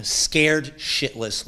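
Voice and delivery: ordinary delivery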